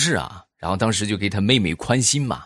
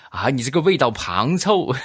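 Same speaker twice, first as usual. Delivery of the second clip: higher-pitched mock Chinese regional accent; playful imitation of an unknown dialect; imitated regional dialect; 5 semitones higher than usual. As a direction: mimic a comical local dialect. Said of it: "不知道是什么地方的方言".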